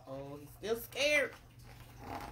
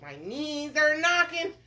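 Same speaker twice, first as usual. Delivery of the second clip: higher, hammed-up worry; nervous cook; 7 semitones higher, 5 dB louder than usual. Cook worries about fishing, acted nervousness. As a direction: fret in a raised voice. Cook lists his fears.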